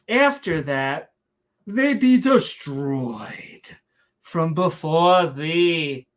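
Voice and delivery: very slow, hammed-up Shakespearean declamation; mock Shakespearean accent. Warning against following other nations, hammy mock Shakespearean accent. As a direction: stretch every word in fake Shakespearean. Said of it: "we're saying it in a Shakespearean accent".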